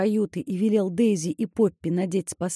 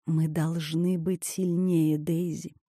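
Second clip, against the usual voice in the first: soft, slow, low whisper; 5 semitones lower, 6 dB quieter, slower than usual. Acted. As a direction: hush it, slowly and lower. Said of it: "прошептала она".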